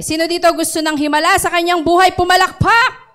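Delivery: loud, high rallying call to the church; preacher shouting for applause; rousing call. Real, not acted. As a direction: lift the room up.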